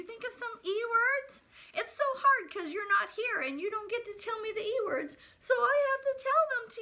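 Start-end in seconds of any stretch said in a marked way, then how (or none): none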